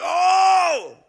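very loud, high startled cry; shocked gasp. Retold or imitated. Preacher reliving the cliff jump, loud high startled cry. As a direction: let out a sudden frightened Oh.

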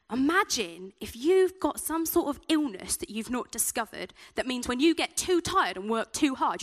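ordinary delivery

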